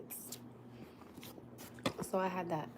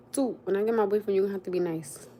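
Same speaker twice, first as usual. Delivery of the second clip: louder, insistent restatement; louder repeat; 6 dB louder than usual. Shown as a demonstration. Mother repeating her warning to her son, louder. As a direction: say it again with more force. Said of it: "I said".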